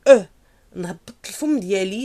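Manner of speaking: usual speaking voice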